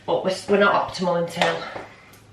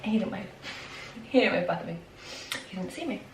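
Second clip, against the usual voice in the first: hushed; 10 dB quieter than usual. Real, not acted. Hiding, boyfriend leaving.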